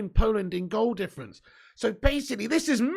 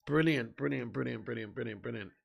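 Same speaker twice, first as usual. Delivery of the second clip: repeated, each one softer and lower until it dies away; fading repetition; 9 semitones lower, 8 dB quieter than usual. Real, not acted. Streamer admiring the free kick to chat, fading. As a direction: savour the word as it trails off.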